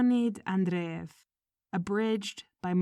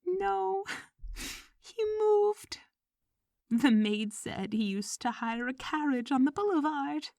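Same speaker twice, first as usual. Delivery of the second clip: anxious wife; 7 semitones higher than usual. Acted. Evpraxia explaining, anxious.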